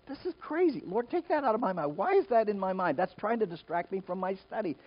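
high-pitched, incredulous complaint; acting out baffled exasperation; exasperated protest. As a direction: protest as if arguing with an intrusive thought. Acted protest to the voice.

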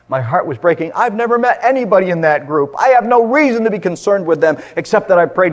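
loud, high impassioned preaching; rising pulpit fervour; full-force preaching. Acted.

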